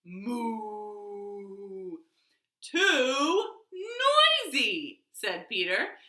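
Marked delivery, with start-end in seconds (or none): 0.0-2.0 soft cow moo. Pretend. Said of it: "and the cow said". 2.6-5.0 slow, drawn-out fed-up whine; exasperated complaint. Acted. Peter fed up.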